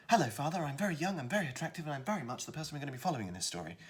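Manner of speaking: high, quick, eager youth; young hero brother. Shown as a demonstration. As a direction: gabble it brightly as the young son. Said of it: "we've got brother number one".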